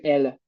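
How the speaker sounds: "ele" with the last vowel nearly dropped; European Portuguese pronunciation. Shown as a demonstration. Teacher comparing countries, "ele" with swallowed final E. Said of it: "en Portugal te van a decir".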